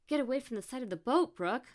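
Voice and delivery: high, loud, hurried alarm; anxious quick shout; fearful warning. A narrator's reading. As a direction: call out urgently, afraid for your friend. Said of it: "Fear laced Etta's voice".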